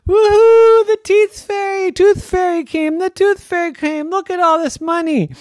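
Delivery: high, loud, cheering woohoo; daddy overjoyed at the tooth fairy money, whooping; excited whoop. Acted. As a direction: celebrate the money like a kid. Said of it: "he started yelling and screaming".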